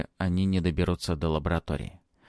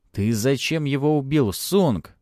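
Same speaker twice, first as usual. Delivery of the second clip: high, loud, agitated question; near-hysterical outburst; almost hysterical shout; 5 semitones higher, 5 dB louder, slower than usual. A narrator's reading. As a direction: cry out at the killer. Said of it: "почти истерично крикнул Антон".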